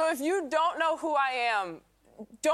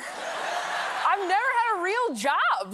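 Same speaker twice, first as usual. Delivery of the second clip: laughing; 7 semitones higher, much slower than usual. Real, not acted. Admitting with a laugh.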